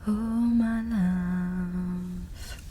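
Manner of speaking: ordinary talk, nothing marked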